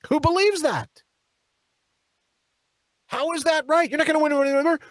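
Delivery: high, incredulous and exasperated. Real, not acted. Pundit scoffing at his opponents' excuses, incredulous. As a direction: scoff in disbelief at the idea.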